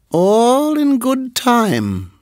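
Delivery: slow high snake voice; menacing. Acted. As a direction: drawl the threat slowly. Nagaina voiced.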